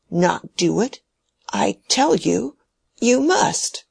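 put-on a frail old man shocked at being refused, rising to a shaky high cry. Dying old man to Mary, shocked at her refusal, shaky and high. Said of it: "his voice beginning to shake".

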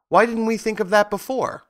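high-pitched exclamation; crying out. A narrator's reading. Scarecrow to friends on hearing a good idea, crying out high. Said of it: "cried the Scarecrow".

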